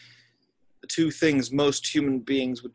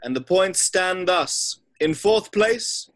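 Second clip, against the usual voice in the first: louder and higher, slowing down toward the end; grand announcement; 8 semitones higher, 6 dB louder than usual. Acted. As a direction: proclaim it to the whole hall. Headmaster addresses the feasting hall.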